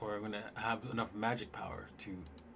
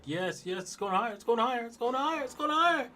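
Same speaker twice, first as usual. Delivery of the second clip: much higher and louder, rising with each repeat; mock excited buyer; 14 semitones higher, 7 dB louder than usual. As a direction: squeal faster and higher as the price climbs. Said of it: "And then it's like".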